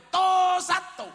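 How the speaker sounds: loud, high mock Madurese count. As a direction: bellow the count like a Madurese recruit. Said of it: "Madura nggak bisa. Berhitung". (hitungan ala Madura, lantang)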